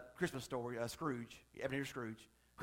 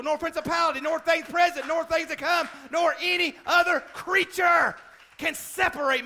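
high, loud fiery sermon; preaching; 12 semitones higher, 10 dB louder than usual. Acted. Preaching at full force.

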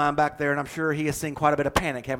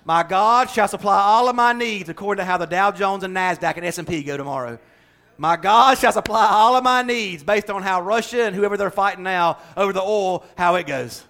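louder, mock misquoted scripture; sarcastic twist on scripture, louder; mock misquote; 4 semitones higher, 6 dB louder than usual. Shown as a demonstration. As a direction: ham up the wrong version of the verse.

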